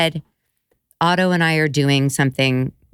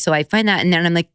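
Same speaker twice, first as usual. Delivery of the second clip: ordinary delivery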